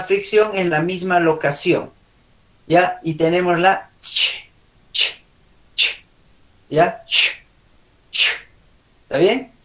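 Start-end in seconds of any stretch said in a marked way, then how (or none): 4.0-6.0 "ch" sin voz, sin vocal; sonido de la ch. Shown as a demonstration. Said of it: "no el nombre de la letra". 7.1-8.3 "ch" sin voz, sin vocal; sonido de la ch. Shown as a demonstration. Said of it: "no el nombre de la letra".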